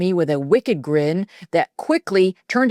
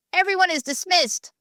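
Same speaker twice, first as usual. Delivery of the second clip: hurt dismissal; 12 semitones higher than usual. A narrator's reading. Hurt teacher dismissing class.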